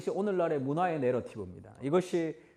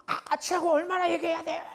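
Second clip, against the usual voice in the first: rude exasperation; 12 semitones higher than usual. Retold or imitated. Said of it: "뭐 이렇게 아주 버릇없는".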